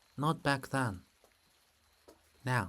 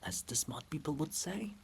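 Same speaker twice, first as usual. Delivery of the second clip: soft whispered ASMR chat; soft whispering vlog aside; 9 dB quieter than usual. Real, not acted. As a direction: whisper gently over the rain. Quiet ASMR-like outdoor aside.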